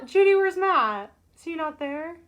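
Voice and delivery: usual speaking voice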